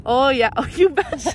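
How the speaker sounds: laughing, hammed-up Minnesota "Oh, you betcha"; playful Upper Midwest catchphrase through a laugh; Minnesota accent. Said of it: "I've had an accent since being here".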